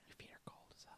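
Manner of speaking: breathy whispered complaint. Real, not acted. To his partner about her cold feet, in a whisper. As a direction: hush it right under the breath.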